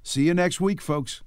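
normal talking voice

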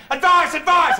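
loud, high mock cry from the water; calling for help. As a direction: yell out as if drowning. Mimics drowning person's cry for help.

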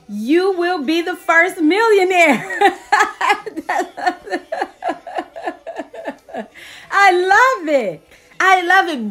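high, loud, laughing delight; laughing with joy. Real, not acted. Preacher laughing with joy at the good news. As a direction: bubble over with laughter. Joyful excitement over being free.

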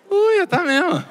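high-pitched startled squeal; mock startled reaction. Hospital worker seeing the positive test, mock startled squeal. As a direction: imitate someone recoiling in high-voiced alarm. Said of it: "Ela fez assim, ó, gente".

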